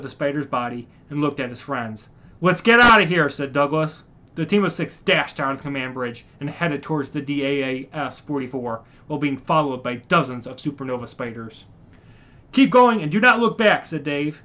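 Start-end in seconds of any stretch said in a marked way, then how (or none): 2.4-3.3 urgent order. Acted. 12.5-13.8 higher, louder urgent order; urging the team past the spiders; urgent command. Acted.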